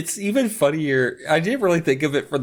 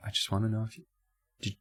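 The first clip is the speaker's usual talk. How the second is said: suspicious whisper; 4 semitones lower, faster than usual. Retold or imitated. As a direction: whisper it like a secret interrogation. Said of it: "Mom's just like".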